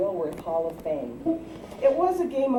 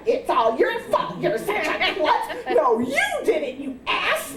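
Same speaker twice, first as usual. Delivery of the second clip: squabbling; 8 semitones higher than usual. Retold or imitated. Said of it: "and then began to fight".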